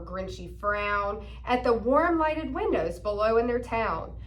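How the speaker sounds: ordinary delivery, nothing marked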